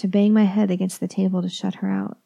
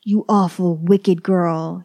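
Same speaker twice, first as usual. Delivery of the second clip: angry; 3 dB louder, slower than usual. A narrator's reading. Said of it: "he yells".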